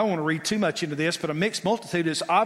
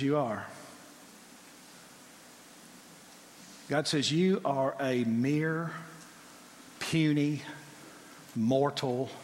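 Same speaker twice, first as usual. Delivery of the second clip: God's voice; 6 semitones lower, 9 dB quieter than usual. Acted. God humbling Pharaoh, low and hushed. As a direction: speak as a quiet, terrible God.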